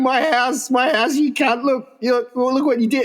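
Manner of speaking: high, mock-upset wail; upset chief. Chief watching his hut burn, high mock wail. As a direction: wail at the guy who burnt the hut. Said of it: "he's going".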